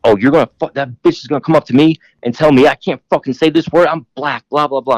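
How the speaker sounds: mild mock yelling; mock ranting. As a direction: mimic a drunk guy's indignant rant. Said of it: "screaming like".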